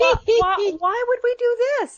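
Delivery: very high-pitched incredulous protest; dismayed disbelief. Real, not acted. Daughter objecting to her mother's topic, incredulous. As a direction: squeal the question in dismay.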